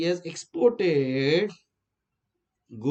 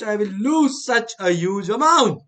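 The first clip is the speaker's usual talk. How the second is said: mock panic; 4 semitones higher, 5 dB louder than usual. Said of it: "your friend starts crying".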